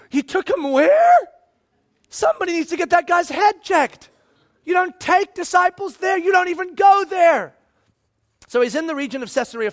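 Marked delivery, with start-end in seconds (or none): 0.1-7.5 be appalled, nearly shouting in disbelief; shocked gasp. Retold or imitated. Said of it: "you'd all gasp and go".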